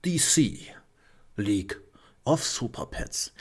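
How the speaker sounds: dramatic trailer announcer voice. Pretend. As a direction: present the title like a trailer. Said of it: "Muss ja die Trailerstimme machen".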